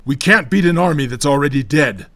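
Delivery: forceful, anxious shout. A narrator's reading. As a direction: shout it as a warning. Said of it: "Armel shouted, gripping his rifle anxiously".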